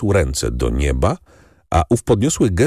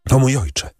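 higher-pitched, lightly voiced distressed daughter; distressed cry; 7 semitones higher than usual. A narrator's reading. Daughter bringing bad news to her father, a restrained anguished cry. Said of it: "zawołała dziewczyna, załamując ręce".

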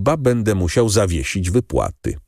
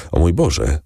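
exclaiming in dread. A narrator's reading. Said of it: "zawołał Morel, blednąc".

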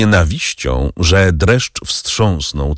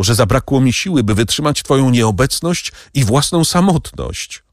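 wyższy, żałosny kobiecy głos; weź winę na siebie, błagając; skrucha Mercedes; 6 semitones higher than usual. Acted. Mercedes blames herself. (Mercedes prosi Edmunda, by mścił się na niej, wyżej i z żalem)

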